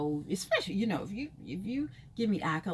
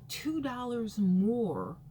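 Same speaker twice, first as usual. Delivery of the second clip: two dollars more, drawn out slowly and heavily; slow complaint; 3 semitones higher, much slower than usual. Real, not acted. Complaining about gas prices, slow and heavy.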